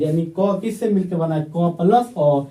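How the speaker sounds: ordinary delivery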